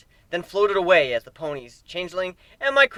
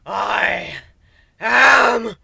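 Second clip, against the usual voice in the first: slow, loud booming mock villain proclamation; hammed-up villain rant; 10 semitones higher, 12 dB louder, much slower than usual. A narrator's reading. Griffin fakes embracing the power, hammed-up villain roar. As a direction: shout it like a drunk-on-power overlord.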